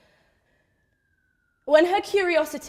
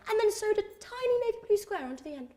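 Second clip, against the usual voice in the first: high, quick and squeezed small; tiny-voiced aside; 10 semitones higher, faster than usual. Real, not acted. Daughter describing mum's homemade hijab, high and quick. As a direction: shrink the voice up high for a tiny detail. Comic detail of sewn square.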